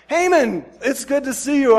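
loud, high, delighted king's hello; eager greeting. Retold or imitated. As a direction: boom out a hearty welcome. King greets Haman eagerly.

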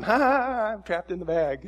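put-on giggling like a kid trapped in a sleeping bag; playful kid laugh. Kid in a sleeping bag, laughing and pretending to be trapped.

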